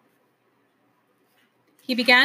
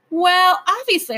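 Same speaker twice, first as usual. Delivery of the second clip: emphatic; 9 semitones higher, 6 dB louder, slower than usual. Acted. Wayne Doodle emphatic.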